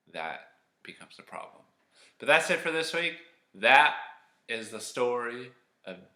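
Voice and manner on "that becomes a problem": That becomes a problem whispered, no voice; whispered teaser of That becomes a problem; ominous whisper. Shown as a demonstration. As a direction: hush it like a secret warning.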